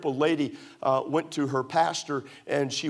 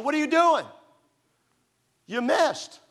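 high, louder mock wife voice; incredulous wife; 10 semitones higher, 4 dB louder than usual. Wife scolding her new husband over the shirt, in a high incredulous voice. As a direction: tease him for missing the basket.

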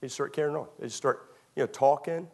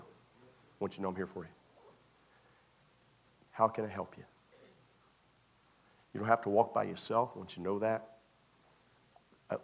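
much softer, gentle encouragement; reassuring pastor; 7 semitones lower, 8 dB quieter than usual. Shown as a demonstration. Pastor comforting a struggling member, soft and reassuring. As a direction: lean in and comfort him quietly. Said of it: "This is preacher now".

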